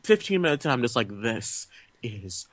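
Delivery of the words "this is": very slow, quiet, word by word; deflated verdict. Retold or imitated. Moviegoer fifteen minutes into a bad film, deflated. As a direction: drop it softly with long gaps.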